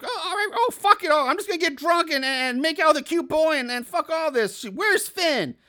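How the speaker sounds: high, brash snap, voice pushed way up; fed-up party girl lashing out; snapping drunk party girl. Retold or imitated. Voicing snapping Alexa.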